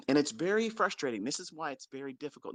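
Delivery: normal talking voice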